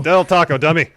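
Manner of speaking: bully the cashier as the cocky champ; L A Knight. Acted. Ordering at Del Taco, loud brash L A Knight impression. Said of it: "L A Knight's gonna take the lead".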